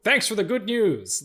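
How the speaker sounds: high, loud, drawn-out sarcastic thanks; hammed-up irate boss brushing off bad news; J. Jonah Jameson. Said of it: "Jonah Jameson's great in that".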